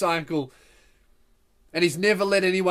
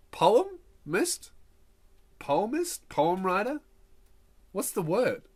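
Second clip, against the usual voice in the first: unsure, questioning, trailing softer; puzzled word-searching; 2 semitones higher, 4 dB quieter, slower than usual. Acted. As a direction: try out each word doubtfully. Groping aloud for the right word.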